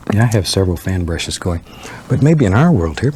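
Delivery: usual speaking voice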